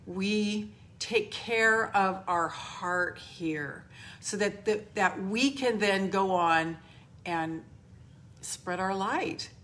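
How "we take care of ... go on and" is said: normal talking voice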